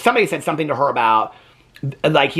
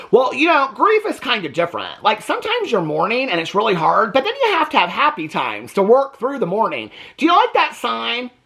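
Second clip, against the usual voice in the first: lightly voiced, higher mocking imitation of the husband; mock Kody voice; 7 semitones higher than usual. Vlogger mocking Kody's take on grief, in a higher mock voice.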